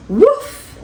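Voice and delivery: high, yippy little puppy bark; squeaky cartoon puppy woof. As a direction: bark like a tiny playful puppy. Puppy barking in story.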